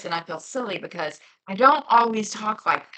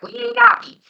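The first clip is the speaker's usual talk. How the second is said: imitating Leslie; 11 dB louder than usual. Vlogger mimics friend Leslie's Southern phrase, high loud Southern twang. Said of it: "she says things like".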